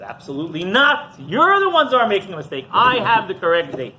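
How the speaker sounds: emphatic denial. Retold or imitated. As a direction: act out stubborn self-righteousness. Rabbi rebuffs the visiting rabbis.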